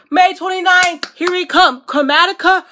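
gasp, then a high, loud thrilled announcement; excited gasp. Real, not acted. Fan gasps announcing album date.

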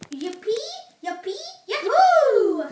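building cheer, last whoop long and loud; rising, drawn-out celebratory whoop. Pretend. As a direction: whoop it up, stretching the final cheer. Leading three cheers after the win.

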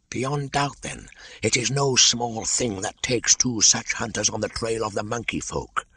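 high, slithery python voice; a wary old snake, curious; a lightly hissing old python; Kaa the python. Acted. Kaa's voice in reading.

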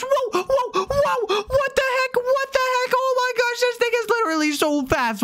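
very high, squealing astonishment; alarmed thrill, pitch shooting up; shocked amazement. Real, not acted. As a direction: squeal as the speed catches you out. Electric car launches very fast.

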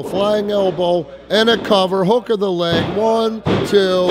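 ordinary delivery

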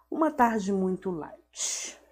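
ordinary talk, nothing marked

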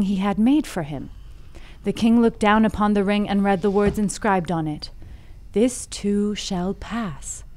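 measured fable storytelling; story narration. A narrator's reading. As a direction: tell it like an old fable, with deliberate phrasing. Reads the fable aloud.

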